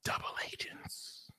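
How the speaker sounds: slow breathy whisper; spooky whisper. Acted. Revealing the double agents to viewers, whispered slowly. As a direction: whisper the name like a secret.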